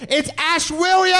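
high, rising MC holler; big stretched stage announcement; shouted guest introduction. Real, not acted. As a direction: yell the name to bring on the guest.

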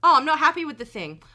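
mock whiny customer complaint; angry customer.

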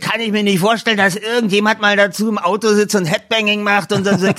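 high, fast, scoffing producer voice; mock producer sneering at a six-minute song; dismissive producer. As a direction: scoff at the song like a doubting record boss. Voices [name]' producer dismissing Queen.

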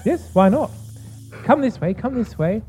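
put-on very high old gnome hostess; high, lively old gnome woman voice; older gnome woman. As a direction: usher guests along in a high old lady voice. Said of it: "older gnome woman".

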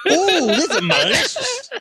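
high laughing gruff savor; a greasy mechanic loving food; angry Southern mechanic. Acted. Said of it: "an angry Southern mechanic".

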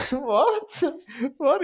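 high, breathless double what; stunned what. Retold or imitated. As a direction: gasp out a disbelieving what.

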